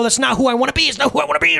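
high protest building to a strained shout; acted self-fighting fit, escalating; mock tantrum. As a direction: whine it, then wail it in mock frustration. Mocks fighting against one's anger.

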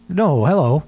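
usual speaking voice

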